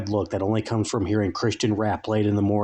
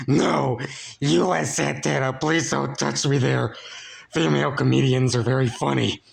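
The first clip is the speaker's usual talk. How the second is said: higher, put-on whimpering voice of a dreaming slob; whimpering sleeper; 4 semitones higher than usual. Slob whimpering in his sleep, higher put-on voice. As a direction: whimper it out like a grubby man talking in his sleep. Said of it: "would occasionally whimper utterances".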